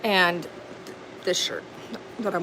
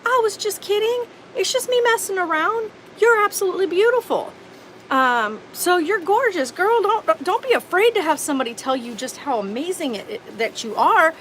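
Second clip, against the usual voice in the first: much higher, laughing sleazy backpedal; mocking the creep; 11 semitones higher than usual. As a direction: play the smarmy guy laughing it off. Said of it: "he proceeds to say".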